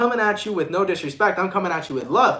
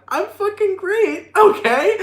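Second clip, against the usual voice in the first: much higher, slow, fake-bright insistence while crying; mock cheerful denial; 5 dB louder, slower than usual. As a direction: squeal a forced I'm great through tears. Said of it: "you're like".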